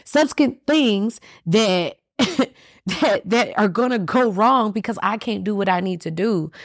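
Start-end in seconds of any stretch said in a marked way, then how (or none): 0.1-3.2 Subsequent drawn out slowly, high and relishing; savoring a big word. Shown as a demonstration. Host showing off the word Subsequent, laughing. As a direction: stretch out Subsequent proudly, with a laugh. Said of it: "That's a big ass word".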